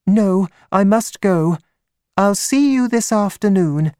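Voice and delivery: high-pitched woman's voice; high, light character voice for a female visitor; young woman client, Miss Stoner. Acted.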